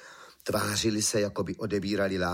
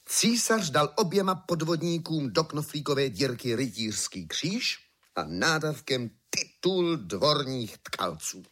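plain reading voice

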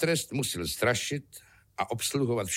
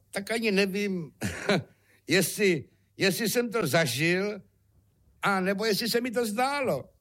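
higher-pitched puzzled hero with a small chuckle; bemused, unsure; 9 semitones higher than usual. Acted. František answers innkeeper and father after the haunted night, bemused. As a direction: wonder aloud, high and halting.

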